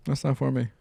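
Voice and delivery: light, airy brush-off of "that's not for me"; breezy dismissal. Retold or imitated. Telling the pushy acquaintance off with a breezy brush-off. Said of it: "easier for me to dodge those things".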